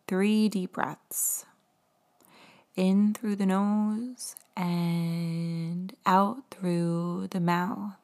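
slow, gentle meditation voice; guided meditation. Acted. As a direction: guide the breathing calmly and slowly. Leads a guided breathing meditation.

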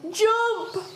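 high shouted command; urgent cry. Acted. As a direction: order the children to leap. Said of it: "cried Grandude".

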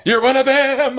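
very high fake-American whimper; mock American accent. Villain posing as a frightened hostage, fake American accent. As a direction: pitch up an exaggerated American plea. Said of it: "It is the most amazing accent".

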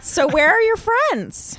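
high, slow mock dad voice. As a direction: play a puzzled dad. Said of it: "he's gonna say".

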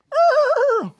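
high, loud imitation whinny; horse whinny. Shown as a demonstration. Preacher imitates a leaping horse, high loud whinny. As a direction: let out a shrill mock neigh. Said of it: "like a horse that's leaping".

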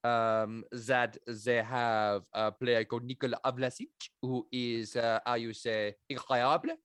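put-on lightly done French accent, questioning lilt; a hint of a French scout, every word a question; French accent impression. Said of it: "classic French impression".

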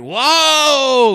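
high, loud startled whoa; crowd gasp. Retold or imitated. Crowd reacting to a knife pulled, startled whoa. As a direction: react like the whole room just jumped. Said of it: "everyone's like".

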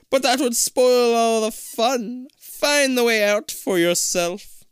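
high, slow, eerie disembodied voice; hollow echoing voice. Acted. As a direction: echo it out like a ghost in the walls. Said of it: "a hollow voice".

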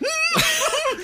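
very high giggling cackle; high bottled-up giggle imitation; imitated Billy laugh. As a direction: burst out in a squeaky giggle. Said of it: "doing the Billy the Beard laugh".

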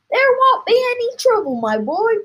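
high, loud interruption; excited father exclaiming; impatient cry. A narrator's reading. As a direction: cut in and brush the worry aside. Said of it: "cried Mister Cranky".

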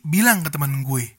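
ordinary talk

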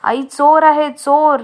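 high, loud, rising panicked calls; children screaming for their mother; frightened cry for help. A narrator's reading. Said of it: "रडायला लागली आणि ओरडायला लागली". (घाबरून मोठ्याने हाक)

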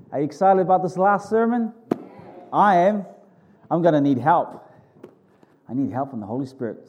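normal talking voice

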